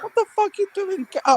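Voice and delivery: high outraged yell; telling them to get up and attack; hammed-up fury; ranting fan. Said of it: "I'm like".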